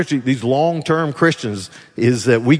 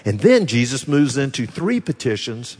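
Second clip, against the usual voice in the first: ordinary delivery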